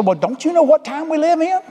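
high, squeaky voice of a scoffer; mocking a doubter, pitched high; mock retort. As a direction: squeak it out like a know-it-all. Imagined listener's retort.

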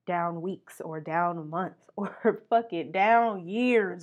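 normal talking voice

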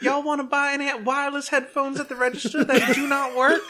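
high, chirpy mascot pitch; squeaky cheerful store mascot; bright squeaky sing-song welcome. Pretend.

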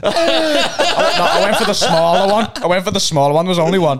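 loud, high-pitched laughing protest. Real, not acted. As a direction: get it out through real laughter. Carries on joke while laughing.

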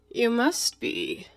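slow, drawn-out cat voice; Cheshire Cat. Acted. The cat answers Alice's challenge, languid. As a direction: stretch it out with languid certainty.

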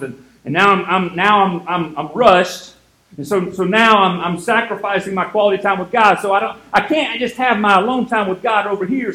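hurried busy-person voice; flustered rush. Pretend. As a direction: sputter like you're running late. Flustered rushing morning rant.

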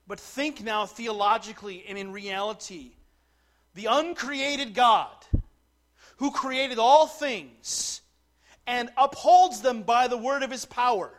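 loud, slow, building proclamation; full-force preaching. Real, not acted. Preacher to congregation on the uncreated God, full-force preaching. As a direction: proclaim it to the back pews, measured and loud.

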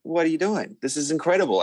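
high, amazed gush; thrilled disbelief. Retold or imitated. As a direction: squeal it way up, overwhelmed with delight. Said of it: "We're just like".

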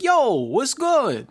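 slow, high, loud imitation of a Russian guy; Russian guy's accent. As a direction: drag it out in a mock accent. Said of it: "it would be like".

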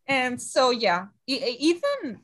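normal talking voice

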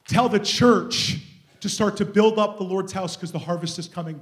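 loud prophetic proclamation, stretched key words; forceful preaching. Real, not acted. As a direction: declare it over the congregation.

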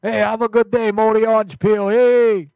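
high, loud hype shout; hollered farewell. Pretend. Host seeing off a departing viewer, high loud holler. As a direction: holler goodbye with a big finish.

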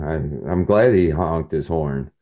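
ordinary talk, nothing marked

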